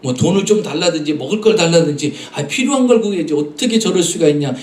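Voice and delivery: murmuring bystanders. Retold or imitated. Bystanders in the crowd grumbling at the blind man's request. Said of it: "주변 사람들은 웅성웅성하지".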